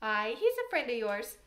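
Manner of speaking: ordinary delivery, nothing marked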